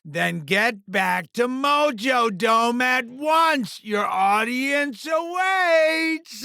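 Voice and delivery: slow, drawn-out showman's order; grand imperious command. Acted. Mojo sending the fighter back to his audience, grand and imperious.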